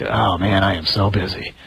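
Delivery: mock weary complaint. Priests comparing schedules, mocking their weary boast. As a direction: imitate a priest bragging he's busy.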